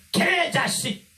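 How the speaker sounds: 아주 높게 내지르는 욕설; 과장해서 새되게 소리치기; 욕하는 사람 흉내. Acted. 대통령을 욕하는 사람 흉내. (버럭 내지르는 고함)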